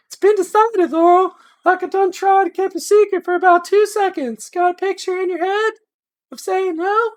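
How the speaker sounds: playing his gentle wife, coaxing; wife's voice. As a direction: lift into a sweet high wife's voice.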